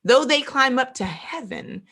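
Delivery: slow, weighty verse reading; preacher slowly reading a warning verse; solemn scripture reading. Acted. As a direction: read each phrase slowly, letting it land.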